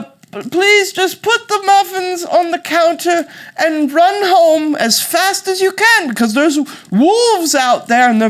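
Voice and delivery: wailing sick granny, high and loud; fake British grandmother. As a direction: shrilly warn the child off in British falsetto. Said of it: "Why are you speaking with an accent".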